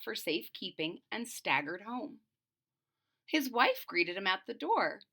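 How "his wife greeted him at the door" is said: ordinary delivery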